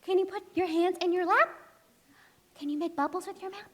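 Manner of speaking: ordinary delivery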